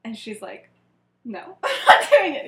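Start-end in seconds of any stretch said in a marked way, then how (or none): 1.2-2.4 laughing refusal. Acted.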